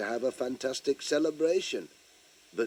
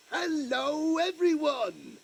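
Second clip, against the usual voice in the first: high, louder shouted hello; slower than usual. Shown as a demonstration. Pat shouting louder for the children to join in, loud call. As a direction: really belt it out this time. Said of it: "you could do it much louder than that".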